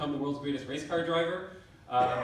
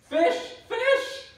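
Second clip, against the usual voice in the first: very high, loud alarmed cry; alarmed shriek; 8 dB louder than usual. Acted. Driver shrieking at fish in the road, alarmed shriek.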